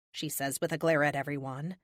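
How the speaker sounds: ordinary delivery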